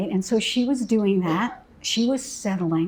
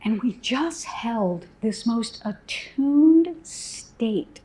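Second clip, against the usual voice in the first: ordinary talk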